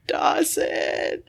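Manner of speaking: snickering, whispered and high; sly nasal snickering whisper. Real, not acted. Laughing whisper of name.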